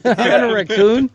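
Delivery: slow, high, laughing through it; laughing repeat. Real, not acted. Repeating the raccoon joke to friends, slow and laughing.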